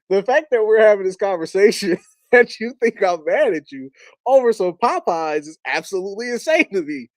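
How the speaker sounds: laughing through the words, amused and incredulous; cracking up at how absurd it is; laughing disbelief. Retold or imitated. Son to dad about the Popeyes worry, laughing in disbelief.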